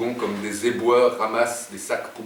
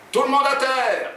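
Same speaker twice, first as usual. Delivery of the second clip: demonstrated robber's cry; 14 semitones higher, 5 dB louder than usual. Acted. Jean shows Oumar what to yell, crying out. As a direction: call it out high, like a hold-up. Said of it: "et tu cries".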